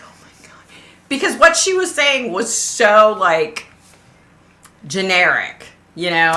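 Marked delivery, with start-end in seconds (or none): 0.0-0.6 voiceless, stunned aside; aghast whisper. Retold or imitated. Viewer reacting to gullible commenters, aghast whisper. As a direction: whisper it under your breath in disbelief.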